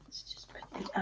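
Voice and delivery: soft unvoiced whisper. Real, not acted. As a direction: whisper it to yourself. Whispering while drawing.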